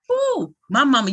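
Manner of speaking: put-on high-pitched "ooh" of a child in awe; admiring child. Host voicing guest's babies looking at mama, high-pitched awe. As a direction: imitate little kids marvelling at their mother. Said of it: "your two babies are looking at mama like".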